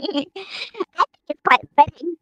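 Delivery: high, slow gremlin-filtered voice; gremlin voice changer. Pretend. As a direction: sound like a giggly gremlin through the changer. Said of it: "You got the gremlin voice now".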